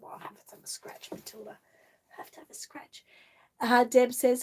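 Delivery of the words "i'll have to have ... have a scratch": hushed whisper, much softer. Real, not acted. Whispering to the doll Matilda.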